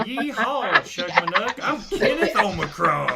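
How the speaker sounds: laughing hammy Southern drawl; southern accent. Pretend. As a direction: yeehaw cowboy voice, laughing. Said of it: "Give him a southern accent".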